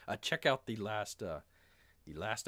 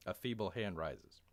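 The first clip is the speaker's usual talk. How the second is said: ordinary talk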